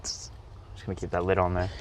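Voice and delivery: whispered, then low and soft; hushed. Real, not acted. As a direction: hush it as if the bug could hear. Afraid the bug gets in.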